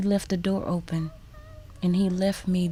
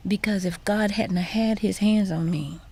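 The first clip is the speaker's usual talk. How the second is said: usual speaking voice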